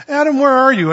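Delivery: God calling for hiding Adam. Retold or imitated. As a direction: call out across the garden.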